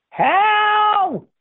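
shrill high shriek from upstairs; nagging wife shrieking down the stairs. A narrator's reading. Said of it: "came a shriek from upstairs".

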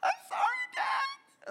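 very high, loud mock squeal; hammed-up falsetto cry; freaking out. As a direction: imitate a panicked little brother pleading. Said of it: "he's starting to freak out".

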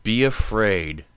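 slow, deliberate warning; slow ominous quote. Retold or imitated. Host quoting The Fly for cohost, slow warning. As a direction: lean on each word like a trailer.

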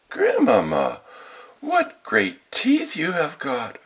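put-on high, drawn-out childlike astonishment; greatly amazed. A narrator's reading. Girl noticing the wolf's teeth, greatly amazed. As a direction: stretch out the wonder as a little girl. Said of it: "being greatly amazed".

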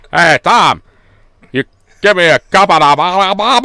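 a Northern Irish accent, hammed up until it turns to "ba-, ba"; imitating Northern Irish accent. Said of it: "the Northern Irish one came out".

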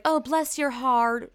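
mock-sweet, drawn-out sympathy; passive-aggressive sweetness. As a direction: draw it out with sugary condescension. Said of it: "is hidden passive aggressiveness".

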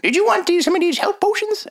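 high, put-on gnome shopkeeper; squeaky-high gnome merchant.

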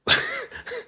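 a short, very high laugh; a squeaky little laugh. Real, not acted. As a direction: let a quick laugh slip out. Laughing about self-conscious dad.

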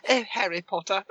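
prim precise enunciation; English governess "Oh, Harry Potter". Retold or imitated. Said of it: "she sounds like a governess".

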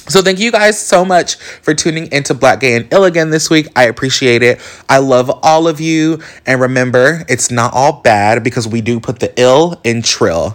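upbeat host farewell; podcast sign-off. Acted. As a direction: wrap up the show cheerfully.

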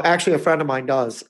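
ordinary talk, nothing marked

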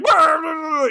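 high, blustering babble; mock Dumbledore outburst. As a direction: splutter like an angry old wizard. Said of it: "like Dumbledore when he thought".

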